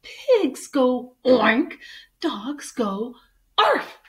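slow lesson, loud animal noises; instructing mother. Acted. Mother dog correcting George on animal sounds, exasperated emphasis. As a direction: drill the pup on the sounds.

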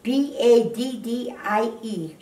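ordinary talk

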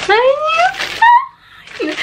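very high, drawn-out gleeful squeal; stretched-out, giggly high squeal; excited squeal. Real, not acted. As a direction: squeal the big reveal with a laugh. Revealing the surprise gift.

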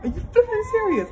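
very high exclamation of disbelief; incredulous outburst. Real, not acted. Vlogger fed up with the crying boy, incredulous outburst. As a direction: cry out in exasperated disbelief.

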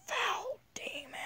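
high slow whispered curse; mock exorcist. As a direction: hiss it like a priest.

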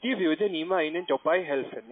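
normal talking voice

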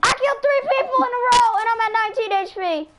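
high, loud, rapid-fire brag; excited shouting. Real, not acted. Gamer reporting three kills in a row, excited shout. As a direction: blurt it out fast and high at full volume.

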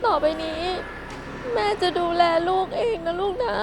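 put-on soft hushing comfort for a crying baby; soothing. Mother softly soothing her crying baby. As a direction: hush the little one gently.

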